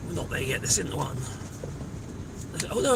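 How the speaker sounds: ordinary delivery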